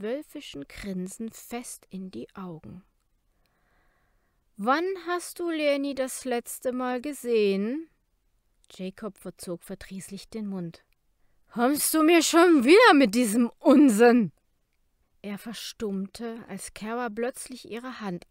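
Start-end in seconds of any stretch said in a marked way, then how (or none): 4.6-7.9 challenging. A narrator's reading. Kara confronts Jacob. 11.5-14.3 put-on loud irritated drunk; barfly. A narrator's reading. Drunk Jacob rants.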